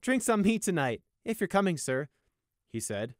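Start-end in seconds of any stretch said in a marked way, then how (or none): 0.0-2.1 higher, louder playful offer; grinning guard. Acted. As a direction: offer drinks with a wary grin. Sunshine offers drinks.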